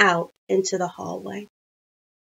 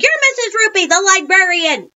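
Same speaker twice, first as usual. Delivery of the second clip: high, loud group accusation; hollering; 10 semitones higher, 7 dB louder than usual. A narrator's reading. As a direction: holler it as the whole class. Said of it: "we all hollered".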